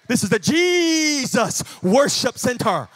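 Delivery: JESUS stretched long and loud, then each word punched; emphatic announcement. Retold or imitated. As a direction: proclaim the name with JESUS drawn right out. Emphatic retort to churchgoer.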